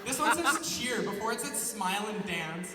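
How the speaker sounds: ordinary delivery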